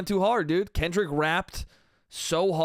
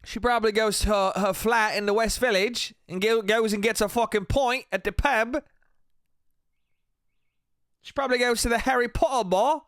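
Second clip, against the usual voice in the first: hammed-up, higher-pitched British accent; exaggerated mock-British twang, pitched up; awful British accent; 7 semitones higher than usual. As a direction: put on a deliberately terrible British accent. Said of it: "how awful your accents of them were".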